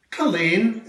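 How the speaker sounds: Culzean said kul-EEN, the z silent; Scottish place name. Shown as a demonstration. Uncle repeating Culzean for the children. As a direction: Culzean with a silent z, stress on the end. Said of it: "That's right".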